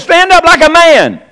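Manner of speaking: high, loud and slow command; commanding God. Acted. God ordering Job to stand up: loud, commanding. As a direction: boom it down like God rebuking.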